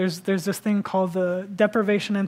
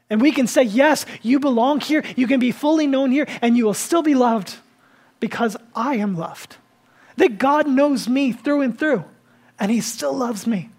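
louder and higher, slowed for weight; fervent preaching; 4 semitones higher, 5 dB louder than usual. Acted. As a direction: proclaim belonging with conviction. Preaching at full force.

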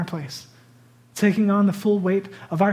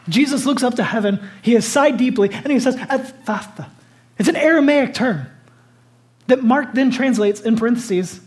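higher, emphatic retelling of scripture; impassioned preaching; 4 semitones higher, 5 dB louder than usual. Real, not acted. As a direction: preach it with rising intensity. Preaches with raised intensity.

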